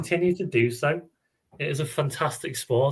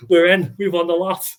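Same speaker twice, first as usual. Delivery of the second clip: higher, louder, hammed-up cheer; mock celebration; 6 semitones higher, 7 dB louder than usual. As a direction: crow like the match is already won. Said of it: "and you'll go".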